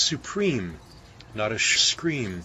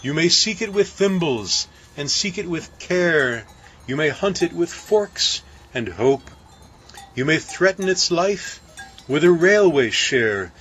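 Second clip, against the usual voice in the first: higher, lightly voiced old uncle; a hint of a wise old uncle; uncle's advice; 7 semitones higher than usual. A narrator's reading. Baker quoting his uncle's advice, lightly voiced.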